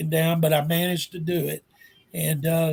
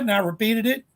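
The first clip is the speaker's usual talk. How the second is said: ordinary talk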